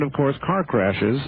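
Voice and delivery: ordinary talk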